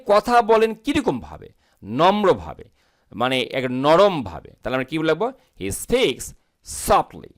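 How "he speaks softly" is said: speaks said very slowly and clearly; teacher slowly shows how speaks should sound; slow pronunciation demo. Shown as a demonstration. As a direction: model the word slowly for students. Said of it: "এটা speak হবে না কিন্তু".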